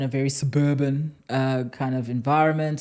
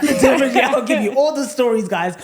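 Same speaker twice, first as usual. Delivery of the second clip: laughing; 10 semitones higher, 7 dB louder than usual. Real, not acted. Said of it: "laughing promise to listeners".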